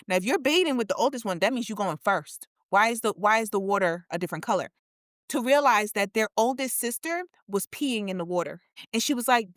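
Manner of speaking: ordinary talk, nothing marked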